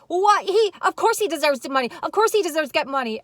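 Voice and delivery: high, loud, fast indignation; mock indignant outburst. Imitating Voices Behind the Wall defending him, high and loud. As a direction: blurt it out outraged, hammed-up. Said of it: "Voices Behind the Wall".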